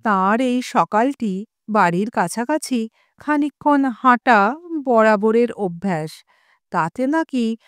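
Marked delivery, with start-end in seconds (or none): none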